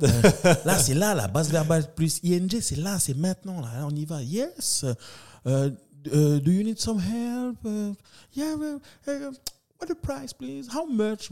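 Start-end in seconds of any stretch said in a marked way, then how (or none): 4.2-11.3 very high mock tourist English; imitating tourist. Tourist asking at the market stall, high mock voice. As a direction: pipe it up like a foreign shopper. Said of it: "les Australiennes qui ont débarqué".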